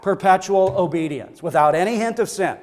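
normal talking voice

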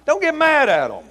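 high, loud mock-stern admonition; hollered warning. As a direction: holler it suddenly at the congregation. Mock-stern warning about visiting evangelists.

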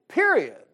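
PERIOD, loud with a high pitch jump and sharp fall; emphatic full stop. Real, not acted. Preacher closing his point on a preacher's job, emphatic. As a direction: punch "Period" high and loud to close the matter.

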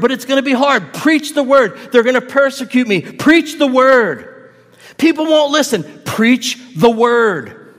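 preaching at full force, each reply slowed and punched; impassioned exhortation. Retold or imitated. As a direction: hammer each objection down. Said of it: "pulled Timothy up by his shirt and said".

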